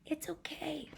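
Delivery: gentle consoling whisper; soft whisper. Acted. Monster comforting crying Sam, whispered. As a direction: comfort him in a hush. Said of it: "That's what he says to Sam".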